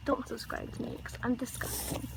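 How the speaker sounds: soft self-mocking whisper; whispered sign-off. Real, not acted. Girl ending her video on herself: self-mocking whisper. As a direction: breathe it out as a joke.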